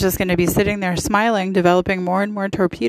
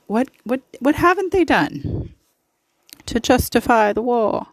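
a hammed-up, much higher English accent; pathetic English accent; 5 semitones higher than usual. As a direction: put on a bad English accent, mocking. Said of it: "In my pathetic English accent".

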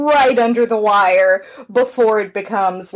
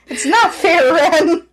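higher-pitched playful protest; mock complaint; 8 semitones higher, 3 dB louder, slower than usual. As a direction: protest like a wronged kid, in fun. Mock complaint to co-host Ren.

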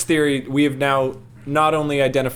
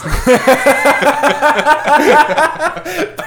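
long high-pitched laughing fit; 13 semitones higher, 5 dB louder than usual. Real, not acted. Player cracks up at joining the ranks, laughing. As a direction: crack up helplessly, high and loud.

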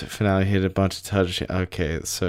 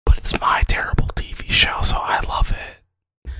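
mock fan whisper. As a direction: whisper it like a guilty secret. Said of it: "is just like".

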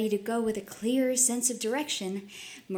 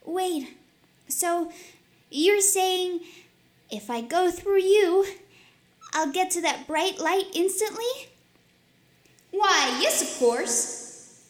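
put-on curious girl; 7 semitones higher than usual. Maria asks, mouth answers.

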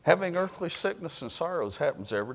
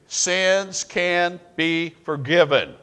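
slow, louder unison-style line for the room to echo; a slow, raised call for the congregation to repeat; congregation call and response; 5 semitones higher, 5 dB louder, slower than usual. Shown as a demonstration. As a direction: lead them in saying it together, slowly. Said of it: "say it with me".

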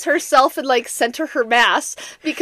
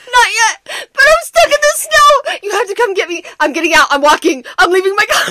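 much higher, louder, near tears; panicked wail; 7 semitones higher, 8 dB louder than usual. Retold or imitated. Wife stuck in snow begging partner to come, crying panic. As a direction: wail it in despair. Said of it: "she's like".

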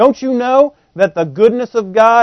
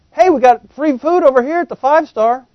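higher and louder, calling across the street; 6 semitones higher, 6 dB louder than usual. Pretend. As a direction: yell out an invitation. Someone yells out to you.

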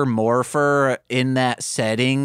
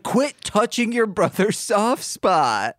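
scolding mom; 7 semitones higher than usual. Retold or imitated. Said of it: "And the mom's like".

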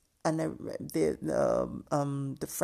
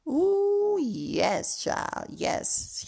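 sassy drawl; 12 semitones higher, much slower than usual. Retold or imitated. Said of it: "when Doctor Heavenly said".

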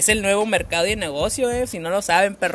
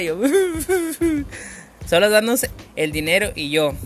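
usual speaking voice

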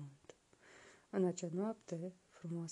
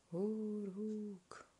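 higher, drawn-out haunting call; the sea calling the boy; eerie call from afar; distant call; 4 semitones higher than usual. A narrator's reading. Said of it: "Își auzea numele strigat".

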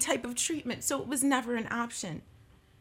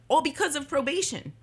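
ordinary talk

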